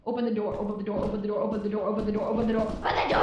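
low, soft, rattled-off repeat; quiet, low looping plea; muttered repetition. Pretend. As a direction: repeat it under your breath like a chant. Repeating a plea under breath.

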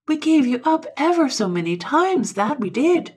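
high, loud, emotional outburst; hammed-up overwrought relief; choked voice. A narrator's reading. Friend tells the patient they gave him up, choked voice. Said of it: "with a choked voice".

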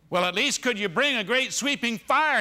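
high, loud begging; loud pleading prayer. Pretend. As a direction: cry out to heaven for a sign. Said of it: "what we're doing is saying".

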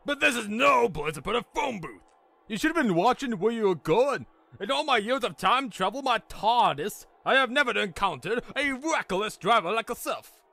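high, outraged English stranger; angry scolding. Acted. Furious stranger scolds the driver.

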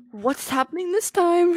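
high, slow, drawn-out question; alarmed surprise. Real, not acted. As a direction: wail the question in mock alarm. Reacts to new game round.

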